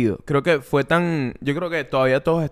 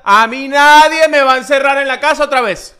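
loud, high-pitched defiant outburst; 항의하듯 외치기; 9 semitones higher, 9 dB louder than usual. Acted. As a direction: shout it like you refuse to be locked in. Acts out everyone refusing another lockdown.

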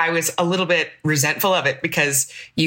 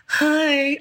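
high, over-gentle mock greeting; imitating cushioning sympathy; 9 semitones higher than usual. Friend imitating people cushioning someone who is sick, high pitying greeting. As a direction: pitch up a pitying little hello. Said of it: "Everybody's cushioning you. Everybody's like".

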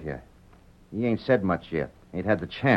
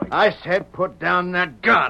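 higher, slow stern repeat; stern command; 8 semitones higher, 4 dB louder, slower than usual. Acted. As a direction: insist on each word slowly. Repeats warning, gunman ignores it.

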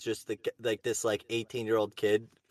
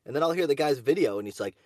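ordinary talk

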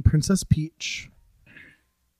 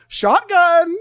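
high, loud shout calling it out; yell; 13 semitones higher, 5 dB louder than usual. Retold or imitated. Storyteller re-enacts calling shotgun to the swat men, yelling. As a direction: yell it like claiming the front seat. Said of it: "I yell".

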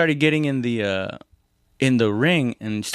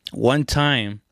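normal talking voice

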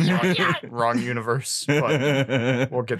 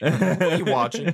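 a high mom voice through laughter; 6 semitones higher, 4 dB louder than usual. Retold or imitated. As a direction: squeak it like a prying mother. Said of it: "I can already hear her saying it".

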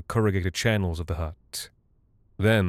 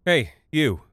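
lightly raised, higher-pitched call; mild shout; 6 semitones higher, 3 dB louder than usual. A narrator's reading. Woman hailing a stranger on the airbase, mild shout. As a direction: call out to him with an understated shout. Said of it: "she shouted".

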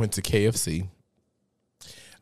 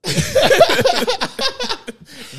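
loud high burst of laughter; big laugh; 10 dB louder than usual. Real, not acted. Storyteller laughing loudly at his own joke. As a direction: crack up loudly.

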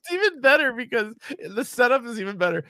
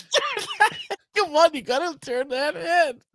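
pleading repeat; 8 semitones higher than usual. Retold or imitated. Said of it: "He goes".

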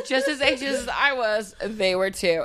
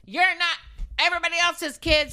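stern parent; 7 semitones higher than usual. Retold or imitated. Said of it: "turned into a parent".

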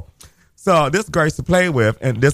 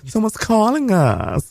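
sing-song announcement; 9 semitones higher, much slower than usual. Real, not acted. Phone rings mid-story.

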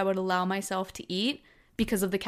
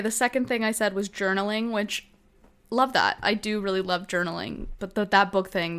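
usual speaking voice

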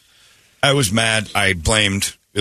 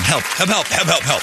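higher, pleading help calls; mock cry for help; 8 semitones higher than usual. Reflection in the car window begging, high mock pleas. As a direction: wave and plead, mock-desperate.

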